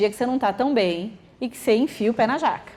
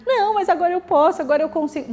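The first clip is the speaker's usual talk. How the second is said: put-on protesting child; 7 semitones higher than usual.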